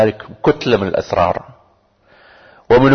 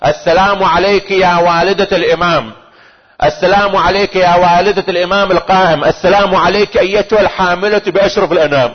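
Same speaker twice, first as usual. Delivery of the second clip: high, measured devotional salutations; ringing prayer recitation; 8 semitones higher than usual. Acted. Preacher reciting the visitation to the Imam's mother, ringing prayer recitation. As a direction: proclaim each greeting with reverence.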